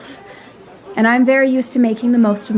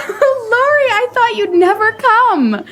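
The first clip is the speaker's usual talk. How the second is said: much higher, laughing greeting; joyful reunion; 12 semitones higher than usual. Acted. Amy greeting Lori after a long time, joyful laughing. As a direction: gush with delight at seeing him.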